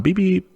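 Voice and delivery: high playful beep beep like a horn; mock car horn. Host jokes that emperor's army drove small cars, beeping. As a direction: toot it out like a little car horn. Joking about tiny cars.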